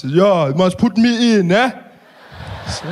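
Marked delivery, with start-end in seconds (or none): 0.0-1.7 slow, louder, higher put-on voice; Malema impression. Malema chatting with Trevor at dinner, slow swaggering voice. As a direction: drawl it with easy swagger.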